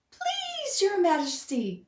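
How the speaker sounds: high, squeaky begging; a tiny mouse begging for mercy; pleading mouse. Acted. Mouse begs the angry lion, high and pleading. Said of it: "he cried".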